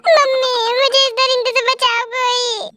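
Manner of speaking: high, hammed-up sobbing plea for help, slow at first; fake crying. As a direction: wail for rescue like a melodrama heroine. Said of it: "इतना drama सीखा कहाँ से". (नाटकीय ढंग से रोते हुए बचाने की गुहार)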